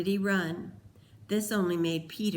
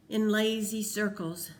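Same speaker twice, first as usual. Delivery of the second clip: usual speaking voice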